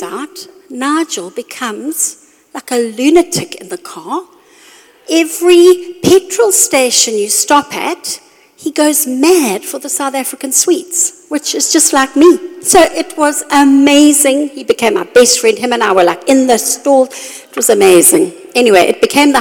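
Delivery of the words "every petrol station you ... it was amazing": higher, animated delight; light up telling it; excited. Real, not acted. Excited retelling of trip.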